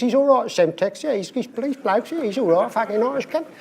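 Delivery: high-pitched Cockney introduction; Cockney trader. Retold or imitated. Cockney introducing the chief economist by nickname, high Cockney voice. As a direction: play a Cockney vouching for his mate. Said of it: "economist of U B S being introduced".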